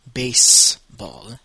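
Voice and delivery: baseball with a clear S, slow; baseball, slow English S for the class; English S sound. Shown as a demonstration. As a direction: stress the S in baseball. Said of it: "avec le son d'un S".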